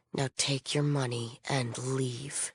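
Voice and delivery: low, slow, quiet contempt; cold dismissal. Acted. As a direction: send them away with restrained disgust. Disappointed dismissal of a mercenary.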